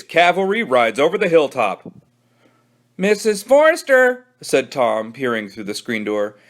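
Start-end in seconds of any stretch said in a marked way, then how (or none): 3.0-4.3 put-on polite kid. Tom calls through door.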